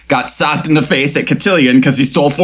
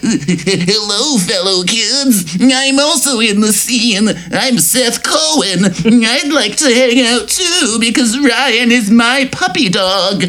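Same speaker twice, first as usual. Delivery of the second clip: put-on nerdy teen voice; 8 semitones higher, slower than usual. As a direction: play the awkward kid begging to join in. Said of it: "I need one hundred percent fewer voices from you".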